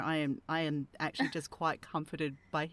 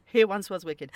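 laughing; 4 semitones higher, 9 dB louder than usual. Real, not acted. Still laughing after joke.

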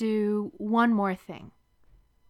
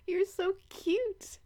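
fond tease; 12 semitones higher, slower than usual. Acted. Fond teasing of lover.

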